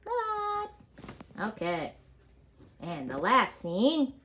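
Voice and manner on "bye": much higher, tiny squeaky bye; high squeaky goodbye. Pretend. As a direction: squeak it out like a little cartoon voice.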